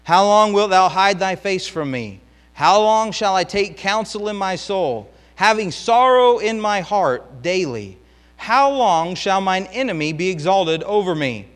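fiery pulpit reading of the lament; preaching at full force. Acted. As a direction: proclaim the psalm like a cry to heaven. Preacher reads psalm at full force.

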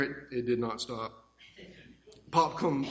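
normal talking voice

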